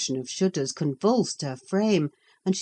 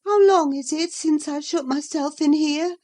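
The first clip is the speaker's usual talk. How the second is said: reviving Catherine; 11 semitones higher, 5 dB louder than usual. A narrator's reading. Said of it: "suddenly reviving".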